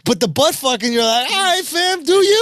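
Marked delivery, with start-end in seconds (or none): 1.3-2.4 mock resigned shrug. Friend reacts to sex scene.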